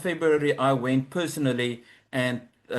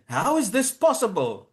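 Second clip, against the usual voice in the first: higher-pitched, incredulous question; astonished secretary; 7 semitones higher than usual. Retold or imitated. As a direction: imitate a secretary's disbelief.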